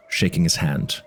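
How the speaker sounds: ordinary delivery, nothing marked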